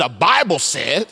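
slow, drawn-out Baptist preacher line. Shown as a demonstration. Preacher coaches the congregation to say it with him, Baptist style. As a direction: say it like a Baptist, lightly put on. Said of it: "Say it like a Baptist person".

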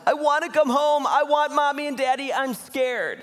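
very high-pitched mock wailing of a small boy; bawling child. Seven-year-old on the phone begging to come home, bawling. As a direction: imitate a scared kid bawling. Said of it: "he's bawling".

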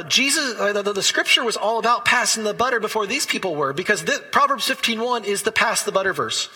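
light up as it finally clicks; excited. Real, not acted. Preacher telling the congregation how the verse clicked, excited. Excited discovery about the proverb.

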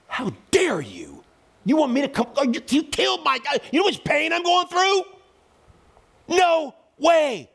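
high-pitched, indignant tirade; angry outburst. Retold or imitated. Pastor at the father of his friend's killer, furious outburst. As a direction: erupt in outrage, voice climbing high. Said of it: "I was so angry".

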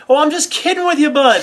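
loud, high-pitched mock cop cheer; jovial prank reveal. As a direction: burst out with the punchline as the joking officer. Retold officer reveals the prank.

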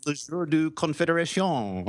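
exaggerated French accent. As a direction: say it with a big French flourish. Reading French name grandly.

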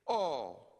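"All." set apart with a different timbre; stress on all. Shown as a demonstration. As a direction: give "All." its own weighty moment. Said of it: "Here it is again".

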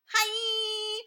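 very high, bright hi; high mock cheery hi; chirpy greeting. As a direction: pop it out cheerfully. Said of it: "And he's like".

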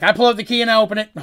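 laughing, rushed player eagerness; eager rush. Retold or imitated. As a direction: blurt it out in a hurry to leave. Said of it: "Mercy's like".

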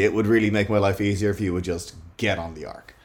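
ordinary talk, nothing marked

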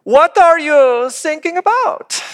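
put-on high, slow, puzzled German accent. German coast guard baffled by a distress call, German accent. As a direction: ask it drawn out in a comic German accent. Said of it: "And the guy goes".

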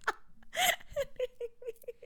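soft, high-pitched laughing fit; high giggling. Real, not acted. Giggling over the friend's delivery, helpless high giggles.

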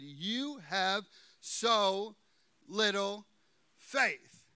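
"You have so little faith" dragged out slowly, word by word; slow rebuke. Acted. Jesus gently rebuking Peter, spoken slowly. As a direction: let each word land.

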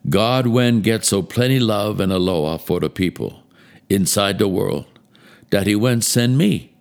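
put-on reading scripture in a light Hawaiian Pidgin accent. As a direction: try a friendly Hawaiian Pidgin lilt. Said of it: "it's into Hawaiian Pidgin".

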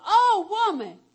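booming "Oh" dropping on "woman"; acted outburst, high on "Oh", then falling; loud exclamation. Jesus to the Canaanite woman, loud amazed exclamation.

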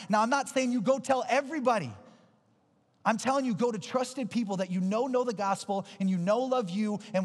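high, emphatic sermon voice; forceful preaching. Acted. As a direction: drive each point home to the crowd.

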